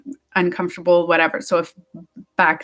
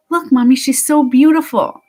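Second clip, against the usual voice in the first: put-on higher, louder voice of an admiring three-year-old; little girl; 5 semitones higher, 6 dB louder than usual. Little girl to her mother about someone, imitated admiring child voice. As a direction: imitate a small child telling Mommy, full of admiration. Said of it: "this cute little girl, about three years old".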